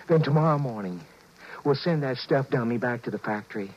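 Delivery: normal talking voice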